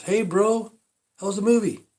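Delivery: chummy bro asking, pitched well up; moviegoer's casual question. Retold or imitated. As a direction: lift the voice high for a casual bro. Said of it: "this guy goes".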